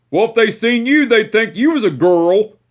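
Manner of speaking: imitating great-grandpa. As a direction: needle him about his hair like a cranky old man.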